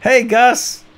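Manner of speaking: mock greeting. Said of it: "He's like".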